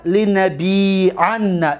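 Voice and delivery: each syllable drawn out slowly; slow drill. Shown as a demonstration. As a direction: recite it slowly for students. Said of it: "drills the verb form slowly". (آهسته و کشیده برای تمرین)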